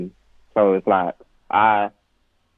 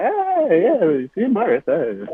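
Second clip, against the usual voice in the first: uncle impression; 9 semitones higher than usual. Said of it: "they'll be like".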